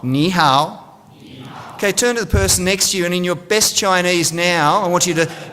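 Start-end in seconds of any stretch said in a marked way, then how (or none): 0.0-0.8 "Ni hao" with Mandarin tones, "ni" dipping then rising; "Ni hao" shown carefully in Mandarin tones. Shown as a demonstration. Speaker leading the audience in saying "Ni hao", with Mandarin tones. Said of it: "let's put that together".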